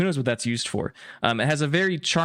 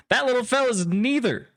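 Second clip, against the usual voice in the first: much higher, hearty sailor scolding his pet; a big sailor fussing over the critter, pitched up; sailor man; 12 semitones higher than usual. Acted. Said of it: "Uh, and he says".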